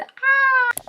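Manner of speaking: high loud delighted squeal; excited squeal. Real, not acted. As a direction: squeal with joy.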